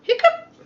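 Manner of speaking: a sudden high squeaky hiccup sound; loud hiccup. Acted. Elephant hiccups, a high loud hiccup. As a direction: pop out a big comic hiccup.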